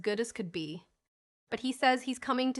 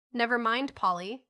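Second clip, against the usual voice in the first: ordinary delivery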